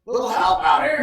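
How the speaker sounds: high, loud call for help. Acted. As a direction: yell over for help. GM voices hillbilly calling out.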